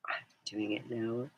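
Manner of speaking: unvoiced whisper to herself; real whisper; whispered aside. Real, not acted. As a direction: breathe it, barely audible. Aside while messaging.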